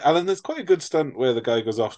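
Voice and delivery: ordinary talk, nothing marked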